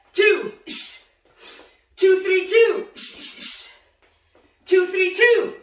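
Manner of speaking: loud, high punch call-outs; shouted counts. Shown as a demonstration. Coach calling punches to boxers, loud counts. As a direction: bark each count out to the class. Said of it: "ready, and let's go".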